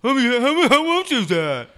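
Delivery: hammed-up high, loud badgering; stammering mock shriek; pestering questioner. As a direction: imitate someone frantically demanding the price. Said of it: "the questions just kept coming".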